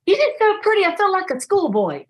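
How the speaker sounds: high, gushing mock schoolboy voice; the delivery guy gushing at her, hammed-up; bashful admirer.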